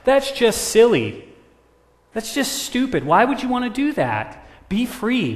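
loud, high-pitched incredulous crow; exaggerated, goading peer pressure; scornful disbelief. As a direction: pile on the mockery, high and loud. Crows scorn parrot's going home.

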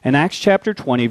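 ordinary talk

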